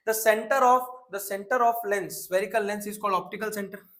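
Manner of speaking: slow, drawn-out recital for repeating; say-along dictation. Shown as a demonstration. Teacher has class repeat the lens definition along, slow say-along dictation. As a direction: spell the definition out slowly, phrase by phrase. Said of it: "मेरे साथ बोलते जाइए".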